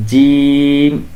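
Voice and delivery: জিম drawn out very slowly with a sharp buzzing j; Arabic jim. Shown as a demonstration. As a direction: stretch জিম long, with a sharp j. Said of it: "একটু মুখে ঝাঁঝালো একটা আওয়াজ বের হবে".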